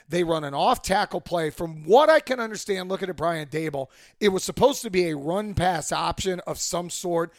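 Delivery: usual speaking voice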